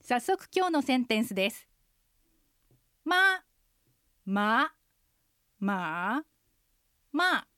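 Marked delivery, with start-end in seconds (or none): 3.0-7.5 四声でま; four Mandarin tones on ma. Shown as a demonstration. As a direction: run ま through the four Chinese tones. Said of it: "中国語のアクセントの練習フレーズです".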